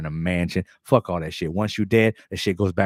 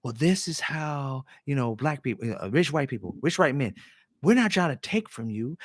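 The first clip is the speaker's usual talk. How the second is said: mock explainer voice; 5 semitones higher than usual. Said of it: "tries to break it down, like, with kid shoes".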